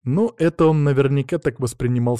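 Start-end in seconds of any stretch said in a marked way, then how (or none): none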